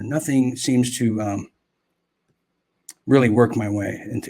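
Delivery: ordinary delivery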